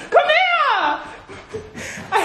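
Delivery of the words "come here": higher, giggling "Come here."; laughing. Real, not acted. Girlfriend calling the angry boyfriend over, laughing. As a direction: coax him while laughing.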